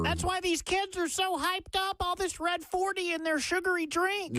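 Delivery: high-pitched put-on grumbler voice; mock scolding complainer. Co-host mocking worriers about red dye, high put-on voice.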